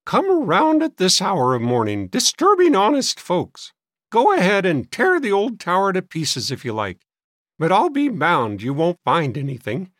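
high, loud grumbling woman; querulous old maid. A narrator's reading. Sister grudgingly letting boys search, querulous.

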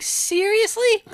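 high, slow disbelieving question; high drawn-out seriously with a laugh; incredulous. Retold or imitated. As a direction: stretch it out in disbelief.

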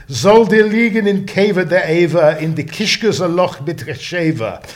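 put-on quoting the old Yiddish curse with an accent he admits fails; self-doubting Yiddish accent. Interviewee quoting a Yiddish curse to host, attempted Yiddish accent. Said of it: "this is where my accent completely fails".

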